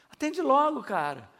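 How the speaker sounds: high, loud push on "logo"; impatient urging. Retold or imitated. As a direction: hurry him up, exasperated. Pastor urges friend to answer. (insistência impaciente, alta)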